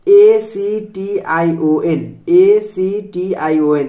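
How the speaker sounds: slow, letter by letter, each letter apart; spelling out. Shown as a demonstration. Teacher spells the word for students, slow letter by letter. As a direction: spell it out slowly for the class. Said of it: "spelling the word out".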